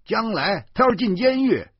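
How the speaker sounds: high, loud haranguing lecture; heated, preachy tirade; relentless shouting. Acted. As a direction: rail at them without letting up. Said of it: "我不依不饶地喊着".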